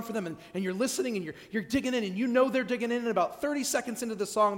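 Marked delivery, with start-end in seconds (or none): none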